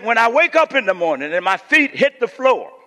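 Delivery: put-on high, loud old-school preacher; loud preacherly impression; Doctor Fred Sampson. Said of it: "This is how he talked, y'all".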